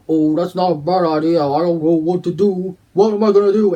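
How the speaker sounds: loud, panicky character voice; Blood's voice. Acted. Blood fretting aloud to Fergus, loud and panicky. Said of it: "Blood will go".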